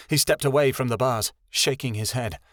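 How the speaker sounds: ordinary delivery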